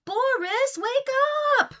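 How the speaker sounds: high, loud call to wake a kitten. Acted. Calling the sleeping kitten.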